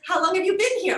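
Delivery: high, loud mock-bright question; chirpy local asking. Imitating locals asking the foreigner, high and bright.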